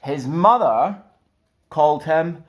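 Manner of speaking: put-on slow, lively read-aloud for children; story narration. A narrator's reading. Stuart reading Where the Wild Things Are aloud, storytelling. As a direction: read it to little ones, rising with the wild parts. Reads a picture book aloud.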